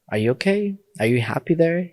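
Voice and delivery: imitating a mother's anxious check-in; concerned mother. Voices mother checking on him.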